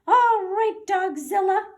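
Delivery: high, loud mouse shout; officer confronting Dogzilla, squeaky shout. Acted. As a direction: call out the dog like a tiny hero. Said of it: "shouted the Big Cheese".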